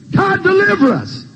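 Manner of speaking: high, pleading call to God; acted desperate cry. Israelites crying to God for rescue, high acted plea. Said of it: "They cried".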